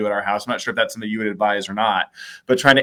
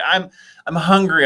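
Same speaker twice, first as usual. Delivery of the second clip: higher, imitating a child complaining; mock whiny kid; 6 semitones higher, 4 dB louder than usual. Dad imitating his kids complaining they're hungry, whiny. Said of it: "My kids say".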